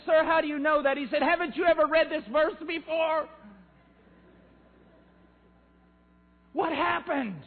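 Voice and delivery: high, loud fervent preaching; preaching at full force. Real, not acted. Preacher challenging the crowd, high fervent shouting. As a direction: shout it to the congregation.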